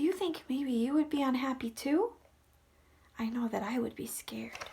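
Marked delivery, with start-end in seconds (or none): 3.2-4.6 soft scared whisper; hushed confession. Real, not acted. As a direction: confide it in a hush. Confiding aside.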